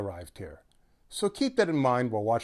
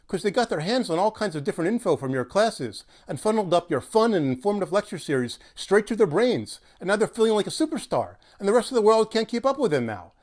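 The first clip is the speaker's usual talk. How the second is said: higher and louder, building as it goes; excited rant; 6 semitones higher, 4 dB louder than usual. Acted. Astrologer firing back at Nadia, worked-up rant. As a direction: pile it on faster and faster.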